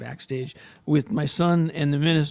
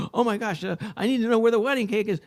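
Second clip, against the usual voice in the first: higher, fast panicky rush; flustered self; 7 semitones higher, faster than usual. Retold or imitated. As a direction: gabble it out in a mild panic. Father panicking about the cake.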